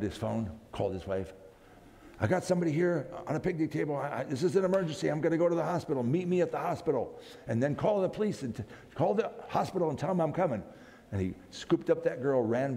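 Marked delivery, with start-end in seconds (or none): 2.2-10.6 fast, higher, urgent emergency call. Retold or imitated. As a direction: rush the news to your wife. Urgent phone call to wife.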